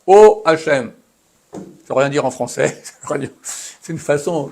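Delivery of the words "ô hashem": loud, raised vocative call; calling on Hashem. Shown as a demonstration. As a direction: invoke God with a strong, high opening. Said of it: "c'est une façon d'appeler Hashem". (appel à Hashem, voix haute et forte)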